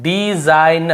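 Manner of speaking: डिजाइन drawn out as "dee-zain"; syllable by syllable. Shown as a demonstration. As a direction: stretch डिजाइन into its separate syllables. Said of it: "हम इसे क्या पढ़ रहे हैं".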